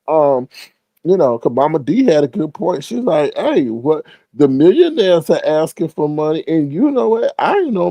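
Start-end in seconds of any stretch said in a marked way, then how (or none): none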